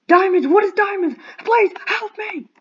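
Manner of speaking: high, loud hammed-up yelling; frantic pretend plea, high and loud; mock frantic. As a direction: shriek it like a cartoon damsel. Mock panic over diamonds.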